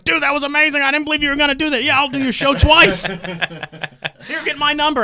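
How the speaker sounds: high, loud, amazed gushing; excited Bill. Retold or imitated. Bill Burr thrilled after the hoop toss, high and loud. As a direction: rave about the stunt. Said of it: "He's like".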